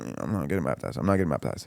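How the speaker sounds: tag muttered refusal. Retold or imitated.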